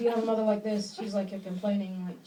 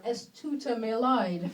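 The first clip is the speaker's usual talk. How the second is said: slow careful German; much slower than usual. Real, not acted. Her apology in German, slowly.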